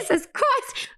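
very high exclamation of despair; hammed-up emotional outburst; overwhelmed wail. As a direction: cry it out at the top of your range. Repeats her overwhelmed reaction.

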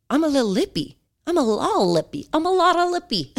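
high, silly little voice; playful cheekiness. Pretend. Podcaster joking about herself, playfully cheeky. Playful self-mockery, laughs after.

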